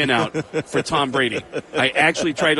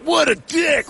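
high-pitched indignant jeer; hammed-up, high disgust; mock outrage; 12 semitones higher, 3 dB louder, slower than usual. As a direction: voice an outraged commenter. Imitating outraged online repliers.

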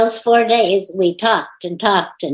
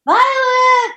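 sharp, much higher shout of the name; shouting for the dog. Real, not acted. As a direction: yell the dog's name to get her attention. Dog not coming, calls louder.

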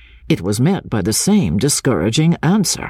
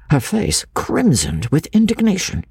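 ordinary delivery, nothing marked

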